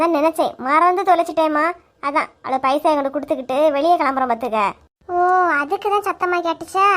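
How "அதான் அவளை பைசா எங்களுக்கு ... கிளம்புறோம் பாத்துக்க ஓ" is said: usual speaking voice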